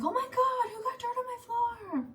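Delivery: very high, hammed-up outrage; pretend-furious mom, pitched very high; mock outraged mom. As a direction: play the shocked mom finding dirt. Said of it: "and be like".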